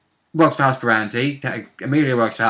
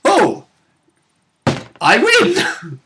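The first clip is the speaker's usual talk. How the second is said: much higher, triumphant squeak; gleeful mock villain; 13 semitones higher, much slower than usual. The game gloating after the fridge opens, high and triumphant. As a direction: crow it like a delighted cartoon villain.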